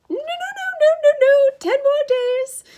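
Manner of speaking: high, pleading falsetto. A narrator's reading. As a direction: haggle frantically in a false lady's voice. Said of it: "Grover said in his falsetto voice".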